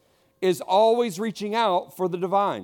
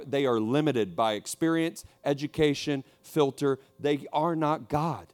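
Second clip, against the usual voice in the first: ordinary delivery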